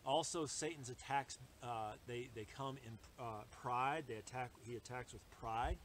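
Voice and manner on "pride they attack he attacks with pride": usual speaking voice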